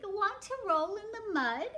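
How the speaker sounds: high, swooping invitation; storybook pig. Acted. Pig invites the spider into mud, high eager voice. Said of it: "grunted the pig".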